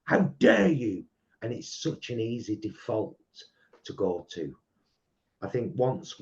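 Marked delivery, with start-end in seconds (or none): 0.0-1.1 angry. Retold or imitated. Imitating angry people.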